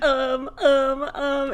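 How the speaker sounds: high, nervous stammered filler; nervous stalling. Retold or imitated. Student who can't read the tiny announcement paper, nervous stalling. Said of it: "He can't do it".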